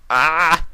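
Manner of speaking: gasping inhale before a sneeze; sneeze build-up. A narrator's reading. Discord straining to hold back his sneeze, gasping. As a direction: catch breath as sneeze nears. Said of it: "squeezed his eyes shut".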